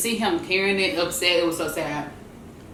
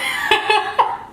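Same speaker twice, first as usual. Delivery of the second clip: breathy laugh; 12 semitones higher than usual. Real, not acted. Laughing at minivan story.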